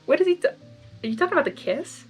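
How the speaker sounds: plain reading voice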